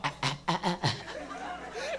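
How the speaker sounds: soft, held-in coughing; stifled cough. Retold or imitated. Comic mimics guy at the back, stifled coughs. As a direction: imitate someone choking back a cough. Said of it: "trying not to cough".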